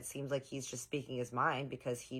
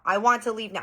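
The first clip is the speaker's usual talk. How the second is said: higher, louder mock demand; retold demand, raised in pitch and volume; louder tantrum demand; 5 semitones higher, 7 dB louder than usual. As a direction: imitate a tantrum getting louder. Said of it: "He starts getting louder and louder".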